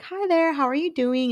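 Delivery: high, sweet lady voice; interviewer's bright high greeting; mimicking the interviewer. Imitating the lovely interviewer lady.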